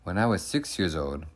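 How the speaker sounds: ordinary delivery, nothing marked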